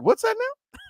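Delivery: very high-pitched mock bafflement; baffled. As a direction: squeak it out, totally lost. Retelling baffled reply to Cajun.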